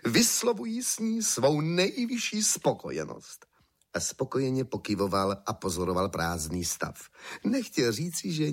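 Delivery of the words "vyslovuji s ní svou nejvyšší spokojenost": high-pitched, pompous proclamation; pompous emperor. A narrator's reading. Emperor feigning approval to the weavers, pompous. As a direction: declare it grandly, pitched well up.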